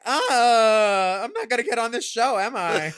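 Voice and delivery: quick, loud groan of self-mocking realization; mock dismay. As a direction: wail it as if the chance is lost. Retelling own reaction at failed audition.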